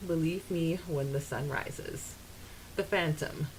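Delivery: ordinary talk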